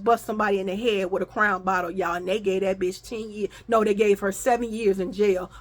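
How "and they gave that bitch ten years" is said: usual speaking voice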